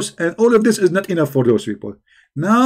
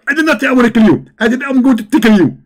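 higher, louder excited preacher impression; Zakir Naik; 7 semitones higher, 4 dB louder, faster than usual. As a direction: lecture like a fired-up debating preacher. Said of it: "If we ask Zakir Naik".

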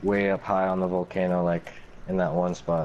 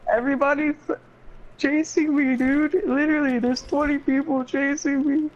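high, frantic panic; being chased, voice shooting up; panicked. Real, not acted. Being chased by twenty players.

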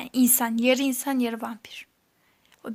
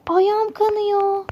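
high, slow, louder whimpering wail; hammed-up cry of pain; mock pain wail; 7 semitones higher, 7 dB louder, much slower than usual. Player caught in the trap, wailing about her bleeding foot.

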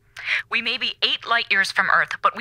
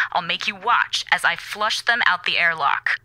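usual speaking voice

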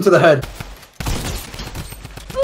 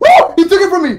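shocked yell; 10 semitones higher, 5 dB louder than usual. Real, not acted. Shocked gamer losing weapon.

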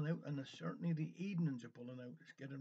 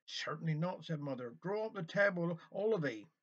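lively Cornish storyteller, a little raised; story reading; 3 semitones higher, 3 dB louder than usual. A narrator's reading. Reading a village kitchen tale to listeners, animated storytelling. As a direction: tell it as a homely dialect tale.

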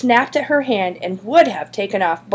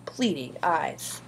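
ordinary delivery

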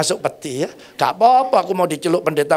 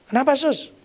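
startled; 7 semitones higher than usual. Retold or imitated. Startled reply to nurse.

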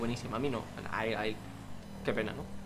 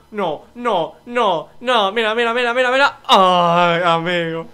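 frantic exclamation; 12 dB louder, slower than usual. Real, not acted. Frantic reaction during race.

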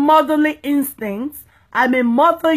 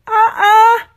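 sharp disapproving uh-uh; 8 semitones higher, 5 dB louder than usual. Real, not acted. Rejecting people bowing to pastors.

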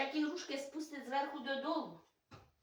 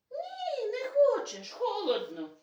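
voicing the dog; 11 semitones higher, 6 dB louder, slower than usual. Mother voicing the dog's refusal.

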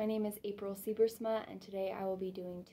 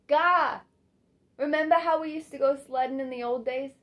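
loud, higher burst of reminiscence; excited outburst; 6 semitones higher, 13 dB louder than usual. Acted. Fanny reminding a listener of old sledding days, loud and excited. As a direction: blurt the memory out loudly.

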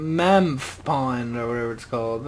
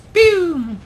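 high, loud "pew"; mock gunshot in a high, loud voice; mimicked shot sound; 14 semitones higher, 8 dB louder than usual. Gamer firing at an enemy, playful shot noise.

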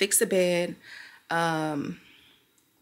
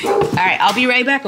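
loud call across the room; 5 semitones higher, 11 dB louder than usual. Real, not acted. Calling out.

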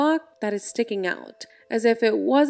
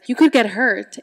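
louder, mock snap of warning; snapping; 6 dB louder than usual. Creepy girl warning her playmate off, snapping. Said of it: "she just snaps at her".

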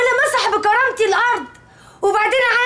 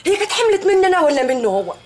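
ordinary talk, nothing marked

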